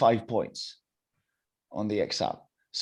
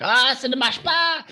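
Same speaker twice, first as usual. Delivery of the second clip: much higher, louder mock shout in French; French woman's shout; 7 dB louder than usual. French woman at tennis camp yelling, imitated shout. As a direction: imitate her frustrated yell on court. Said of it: "she shouts things in French".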